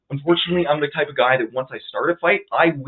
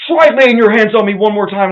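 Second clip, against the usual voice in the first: loud, higher menacing challenge; mock threat; 6 semitones higher, 9 dB louder than usual. The driver threatening after the push, loud high threat. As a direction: dare him loudly.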